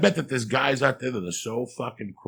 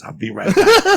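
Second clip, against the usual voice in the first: laughing threat; 8 dB louder, slower than usual. Acted. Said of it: "joke threat".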